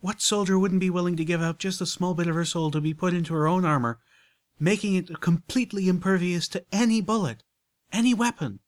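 high, loud, glowing with her idea; rapturous lady. Acted. Rarity pitching soul armor to Applejack, rapturous and loud. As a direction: sell the vision with rapture.

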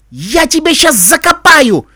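slow, high, loud threatening roar; furious bark. A narrator's reading. Iskorka threatens the boy who misled her, furious roar. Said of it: "рявкнула искорка".

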